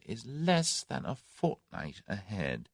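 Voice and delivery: thoughtful. A narrator's reading. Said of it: "he said thoughtfully".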